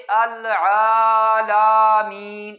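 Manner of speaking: العالمین drawn out very slowly; teacher showing the overly slow العالمین, drawn out; overstretched alameen. Shown as a demonstration. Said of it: "اس کو پڑھنا آہستہ".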